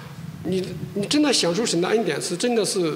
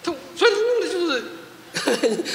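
slowed words dissolving into a soft breathy laugh; embarrassed chuckle; 6 semitones higher, 3 dB quieter, much slower than usual. Real, not acted. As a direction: laugh at your own mix-up. Laughing at own misunderstanding.

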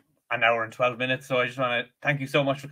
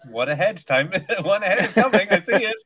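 higher and louder, gleeful build; mock eager; 5 semitones higher, 4 dB louder than usual. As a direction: relish it like a gloating plotter. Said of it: "It's like".

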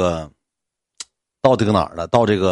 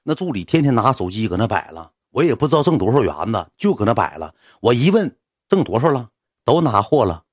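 ordinary talk, nothing marked

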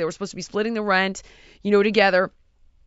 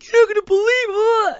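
higher, louder, fast and crying; panicked cry; 11 semitones higher, 8 dB louder, faster than usual. Retold or imitated. Brother on the phone mid-fight, frightened crying rush.

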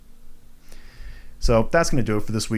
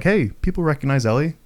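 lightly voiced eager executives, pitched up; mock company pitch; 7 semitones higher than usual. Podcaster imitating game makers cashing in on a sequel, mock-eager pitch. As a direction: pitch it higher, like a money-minded studio. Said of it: "now they're just like".

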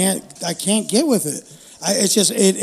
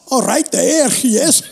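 mock Scottish woman; 4 semitones higher, much slower than usual. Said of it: "That one he does about the Scottish chick".

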